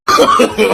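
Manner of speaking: bursting out laughing; a real burst of laughter at the punchline. Real, not acted. Listener cracking up at the joke, laughing loudly.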